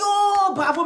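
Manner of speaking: high, loud drawn-out cheer; acted congratulation. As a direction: cheer like impressed doctors. Doctors congratulate patient again. (félicitations enthousiastes, jouées)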